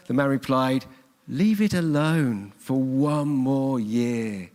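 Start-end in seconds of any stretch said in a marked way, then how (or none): 1.3-4.5 slow, rural West Country gardener; West Country accent. Acted. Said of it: "Why do I say that in a West Country accent?".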